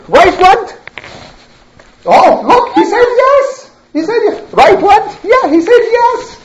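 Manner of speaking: high, excited insistence; squeaky, gleeful acted proof; triumphant pretence. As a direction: crow that the idol has answered. Rival feigns idol answering him.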